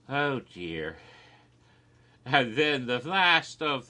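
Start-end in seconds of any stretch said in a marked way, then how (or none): none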